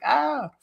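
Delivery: high, small "ah"; helpless yelp. Retold or imitated. Teenager rebuffed by Boston kids, high helpless yelp. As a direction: let out a squeaky little cry. Defeated reaction to bully.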